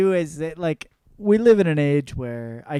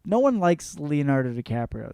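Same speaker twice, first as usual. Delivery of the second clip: ordinary talk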